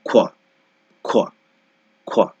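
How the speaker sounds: খোয়া as a short kwa. Shown as a demonstration. Teacher modelling the qu sound for students. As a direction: clip খোয়া quick and rounded. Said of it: "দুইটার উচ্চারণ এখানে চলে আসবে".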